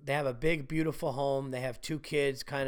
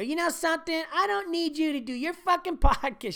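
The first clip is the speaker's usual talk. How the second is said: high-pitched, exasperated wife voice; mock wife; 12 semitones higher than usual. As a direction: play the fed-up wife mocking the podcast. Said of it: "my wife's gonna hear that and go".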